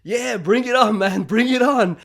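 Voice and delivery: acted defiance. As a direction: play a cocky challenge. Acts out his defiant thought.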